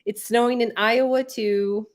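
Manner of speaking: ordinary talk, nothing marked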